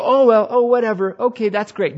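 high, loud, airy shrug-off; mock indifference. Acted careless response, preacher mocks it.

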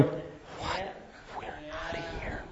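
urgent whisper to his wife; whispered aside. Retold or imitated. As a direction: hiss it privately so the bikers don't hear. Aside to Dawn at the graveside.

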